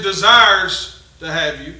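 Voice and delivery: ordinary delivery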